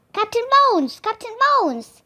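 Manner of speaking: loud, high rough crew shout; pirates' cry. A narrator's reading. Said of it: "took up the cry".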